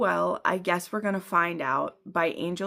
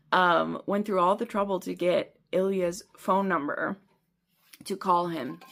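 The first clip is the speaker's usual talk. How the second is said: normal talking voice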